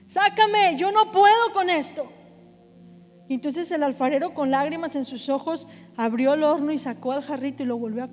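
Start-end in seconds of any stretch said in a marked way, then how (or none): 0.0-2.0 high, loud cry to be pulled out; desperate shouting. Acted. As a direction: shout in pleading panic, stretching the words. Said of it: "el jarrito comenzó a gritar". (grito suplicante y desesperado)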